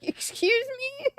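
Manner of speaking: high, drawn-out, loud disbelief; an offended chef's squeal, rising sharply; indignant chef. Acted. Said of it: "the chef was like".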